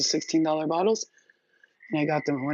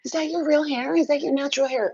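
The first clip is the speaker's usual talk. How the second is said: admiring strangers; 10 semitones higher than usual. Retold or imitated. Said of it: "Everybody's gonna".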